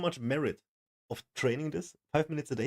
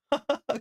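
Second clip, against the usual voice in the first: high burst of laughter; delighted laugh; 13 semitones higher than usual. Real, not acted. Viewer amazed at sounding better, delighted laugh. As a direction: laugh out high at your own improvement. Delighted at hearing own improvement.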